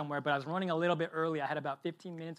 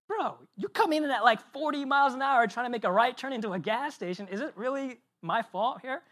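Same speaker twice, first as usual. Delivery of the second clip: raised, louder pushback at the driver; indignant retort; 7 semitones higher, 6 dB louder than usual. Retold or imitated. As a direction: talk back, fed up and defensive. Arguing back at the angry driver.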